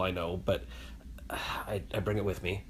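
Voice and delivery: soft unvoiced confession; sheepish hushed admission; guilty whisper. Real, not acted. Confessing cheap illegal habit.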